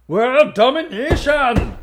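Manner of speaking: hammed-up evil mastermind proclaiming domination; mock villain. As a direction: savour the word like a cartoon villain. Doctor Loeb's answer.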